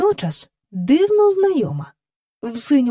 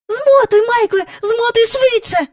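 very high, loud and gasping; breathless urging; 12 semitones higher, 5 dB louder than usual. Acted. Jane urges Michael to reel in, high breathless shout. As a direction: urge him on, out of breath. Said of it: "аж задихалася Джейн".

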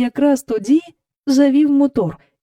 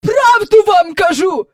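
high, loud insisting; insistent old woman; 11 semitones higher, 8 dB louder than usual. A narrator's reading. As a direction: keep pressing the driver. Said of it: "не вгавала міс Ендрю".

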